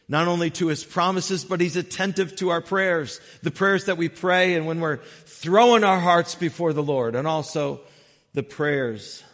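loud, high, impassioned sermon voice; emphatic preaching. Real, not acted. Preacher urging church that God hears prayers, impassioned preaching. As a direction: preach with rising force, leaning hard on key words.

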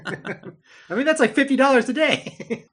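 high, loud, laughing through the words; laughing joke. Real, not acted. Host jokes about the family's movie cost, laughing loudly. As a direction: crack up while doing the math.

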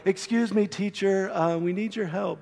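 soft, overly polite, quiet ask; mild mock politeness, hushed; mock gentle request. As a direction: tiptoe the request like a polite student. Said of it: "this was no gentle, like".

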